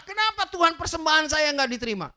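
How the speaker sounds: loud, high-pitched indignant complaint to God; angry protest. Acted. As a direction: act out Cain's furious "why me" outburst. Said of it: "Dia marah".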